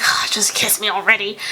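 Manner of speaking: retold impatient whisper; annoyed whisper. Girlfriend retelling her plea for a first kiss, annoyed whisper. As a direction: whisper it like an exasperated aside. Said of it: "She sounded all annoyed".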